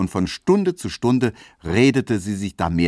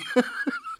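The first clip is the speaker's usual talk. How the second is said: giggle; 5 dB quieter than usual. Acted. Tesch laughing at his story.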